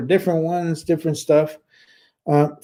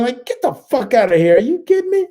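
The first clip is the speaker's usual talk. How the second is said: incredulous outrage; 9 semitones higher than usual. Real, not acted. Outraged at doctor's advice.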